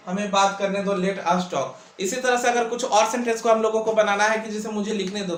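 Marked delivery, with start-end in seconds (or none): 1.0-2.0 very slow and a bit lower; slow model sentence. Shown as a demonstration. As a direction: drag it out for the class to write. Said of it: "teacher models the sentence". (बहुत धीरे बोलकर)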